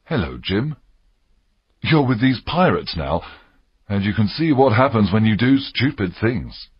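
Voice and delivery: subdued, sorrowful reproach; mildly sad, disappointed doctor. A narrator's reading. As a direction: scold the boy sadly. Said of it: "The doctor began sadly".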